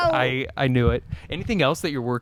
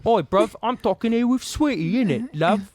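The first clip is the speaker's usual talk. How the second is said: loud, high hammed-up British accent; bad British accent; 7 semitones higher, 4 dB louder than usual. Shown as a demonstration. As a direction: put on a cheeky British street accent. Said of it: "Can you do a good British accent?".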